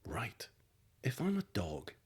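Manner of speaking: hushed thinking aloud, partly whispered; voiced inner thoughts. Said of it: "and my thoughts were".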